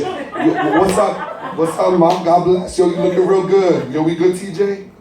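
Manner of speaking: high, easy street voice, chummy; Duncan, friendly now. Acted. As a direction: make peace like nothing happened. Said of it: "And here comes Duncan".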